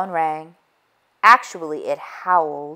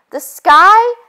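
panicked cry; 13 semitones higher, 10 dB louder than usual. A narrator's reading. Panicked hen remembers the fall.

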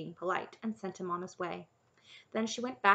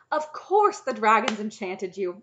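loud, slower burst of realization; sudden enthusiasm; 3 semitones higher, 12 dB louder than usual. A narrator's reading. Knight deciding the princess must be enchanted, enthusiastic. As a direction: seize on the idea with sudden enthusiasm. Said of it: "the knight's sudden enthusiasm".

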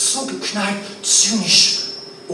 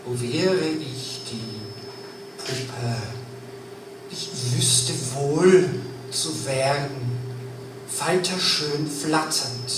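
lowered, unhurried spoken verse over music; poem recital; 6 semitones lower, 5 dB quieter than usual. Acted.

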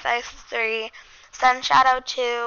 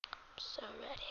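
whispering; 25 dB quieter, faster than usual. Real, not acted. Whispered excitement.

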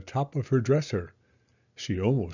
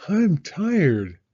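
higher, louder mock weary mom; a hint of a tired mother; 8 semitones higher, 5 dB louder than usual. Mama after the show, mock tired. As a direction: flop down, tired. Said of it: "said Mama".

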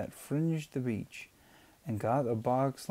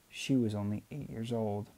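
ordinary delivery

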